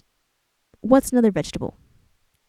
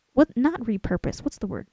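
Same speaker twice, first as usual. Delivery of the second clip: ordinary talk, nothing marked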